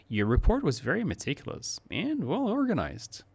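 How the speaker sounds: high, lifted tune on very meticulous; surprised praise. Shown as a demonstration. Teacher praising a report with surprised, high tune. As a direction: sound pleasantly surprised. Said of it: "intones a little bit of a surprise".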